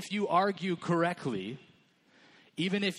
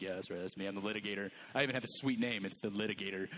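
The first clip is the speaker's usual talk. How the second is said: low, soft, smug bragging; chest-puffing litigator; 7 semitones lower, 7 dB quieter, faster than usual. Retold or imitated. Guys in the room bragging they're the litigator, lower and smug. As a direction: puff up and brag about it. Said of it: "puff their chests and be like".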